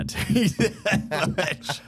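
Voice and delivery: slow words breaking into real laughter; laughing. Real, not acted. Joking with his friend about being well rested, laughing. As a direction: laugh your way through the line.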